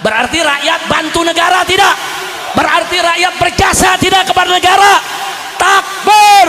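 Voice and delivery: high rallying shout; fiery preaching. Real, not acted. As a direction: drive the questions up to a shouted call.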